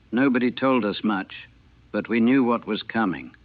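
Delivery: usual speaking voice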